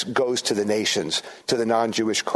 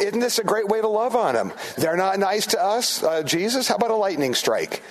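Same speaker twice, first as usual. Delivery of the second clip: higher-pitched sarcastic suggestion; mock eagerness; 7 semitones higher than usual. Disciples proposing lightning on unfriendly villagers, mock eager. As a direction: pitch a terrible idea as if it were reasonable. Mocks disciples requesting heavenly fire.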